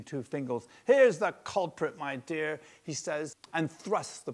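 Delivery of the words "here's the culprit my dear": loud proud announcement; triumphant husband. A narrator's reading. Husband showing the culprit to his wife, triumphant. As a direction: show off the catch.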